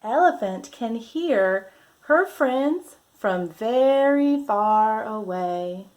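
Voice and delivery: lively, drawn-out picture-book voice; reading to children. Acted. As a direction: read it like a storybook for little ones.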